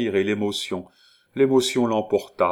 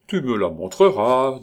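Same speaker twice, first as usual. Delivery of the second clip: indulgent, raised reply; obliging tone; 4 semitones higher, 6 dB louder than usual. A narrator's reading. Neighbour indulging the smitten young man, obliging. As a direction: humour him readily. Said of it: "dit complaisamment la voisine".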